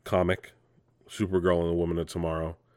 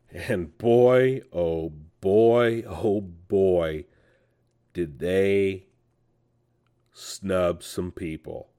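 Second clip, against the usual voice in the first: dramatic build-up; much slower than usual. Real, not acted. Dramatic lead-in to the snubs.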